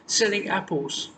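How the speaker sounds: a hint of a squeaky old crone at the door. A narrator's reading. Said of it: "came a squeaky voice".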